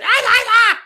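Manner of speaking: very high, loud mock yelling "ah ah ah"; hammed-up toddler shouts; toddler yell imitation. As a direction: imitate a little boy copying dad's yelling. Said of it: "He's just like".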